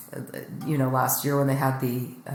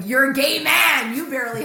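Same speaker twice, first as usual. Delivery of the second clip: exasperated retold shout; 9 semitones higher, 6 dB louder than usual. Scolds yelling friend in retelling.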